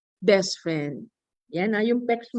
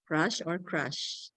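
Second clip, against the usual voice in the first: soft, slow demo; 5 semitones lower, 9 dB quieter, slower than usual. Shown as a demonstration.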